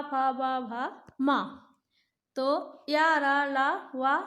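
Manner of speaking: put-on drilling the alphabet with children; reciting consonants. Shown as a demonstration. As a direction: call out the consonants one by one. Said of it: "व्यंजन तैंतीस होते हैं".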